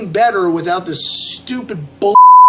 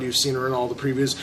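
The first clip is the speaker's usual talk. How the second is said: ordinary talk, nothing marked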